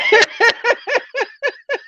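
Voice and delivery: a long high giggle; high laughter. Real, not acted. Host still giggling at the troll page, high laughter. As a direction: keep giggling.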